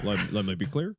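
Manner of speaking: low, halting presidential impression; Obama. Host practising a presidential catchphrase, low halting impression. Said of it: "projection for my Obama".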